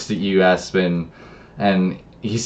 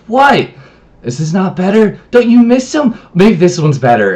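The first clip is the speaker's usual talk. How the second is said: higher, louder mock-innocent questioning; taunting shapeshifter; 8 semitones higher, 5 dB louder than usual. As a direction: needle them in a raised, insistent voice. Shapeshifter taunts attacking player in game.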